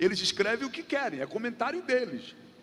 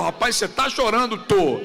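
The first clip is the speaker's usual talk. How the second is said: preacher acts out a dialogue with a weeping believer, shouting; shouted dialogue; 7 dB louder than usual. Acted. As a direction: preach it at full voice, asking and answering. Preacher acting out a loud dialogue.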